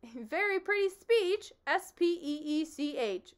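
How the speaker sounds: high mocking sneer, spelling it out; mock the speech with contempt. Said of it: "sneered the bee".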